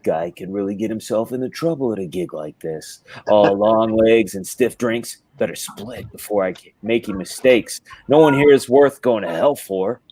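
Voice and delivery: low noir monologue in a put-on voice; Frank Miller. Host parodies a noir author sizing up the party, low impression. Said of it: "read it in his voice".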